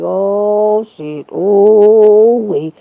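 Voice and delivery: loud, drawn-out "oh" of dismay; hammed-up wail at what is coming; alarmed exclamation. Believer reacting to God's coming wrath, loud drawn-out alarm. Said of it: "I be like".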